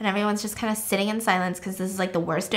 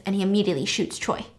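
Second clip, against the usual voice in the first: ordinary delivery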